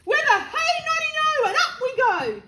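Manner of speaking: high, loud, rollicking call; gleeful shout. A narrator's reading. Grandma growing ever taller, gleeful shout. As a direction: shout it like a jolly chant. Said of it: "she shouted".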